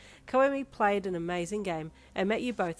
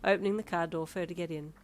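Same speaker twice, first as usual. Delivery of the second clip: plain reading voice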